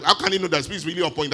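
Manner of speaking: ordinary talk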